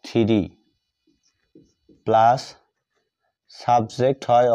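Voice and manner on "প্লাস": ordinary delivery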